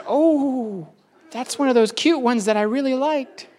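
high, cooing over a cute bird. Retold or imitated. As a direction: gush over the little bird. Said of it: "I said".

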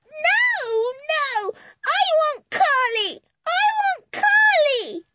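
put-on high, slow wailing little boy; screaming toddler. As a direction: wail like a tantrum child. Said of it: "he began to kick and scream".

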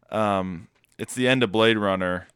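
ordinary talk

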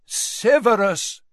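slow, deliberate naming of Severus; clear and firm. A narrator's reading. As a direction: say it clearly and with weight. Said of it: "said Dumbledore clearly".

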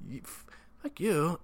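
high, slow put-on huff; offended stammer. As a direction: sputter in offense. Offended by peckerhead.

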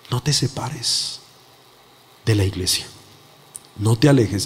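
gentle, grave command with pauses between phrases; voice of God. Retold or imitated. Preacher retells God speaking to him, low solemn counsel.

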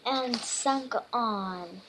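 usual speaking voice

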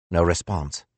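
plain reading voice

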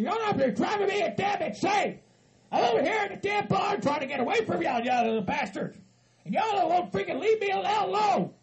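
high-pitched, shouted protest; angry tirade. Acted. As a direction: yell at them to leave you alone. Angrily rejects their help.

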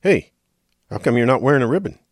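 mock challenge, rushed out; pushy marcher. Marcher confronting Kramer about his ribbon, pushy and quick. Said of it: "one guy looks over and says".

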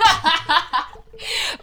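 high bursting laugh; real laugh, high and loud; laughing hard; burst of laughter. Real, not acted. Laughing at friend's reaction.

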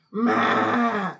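loud grumpy meh; monster grunt. Retold or imitated. Grunts a loud meh at Remy like the Beast. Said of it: "I'm like".

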